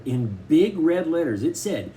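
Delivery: usual speaking voice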